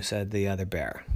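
plain reading voice

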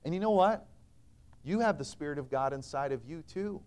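normal talking voice

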